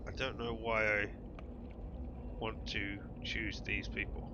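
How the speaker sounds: a hint of a pleading hook creature, slow in places; lightly voiced lonely hook. Voices a fishing hook character.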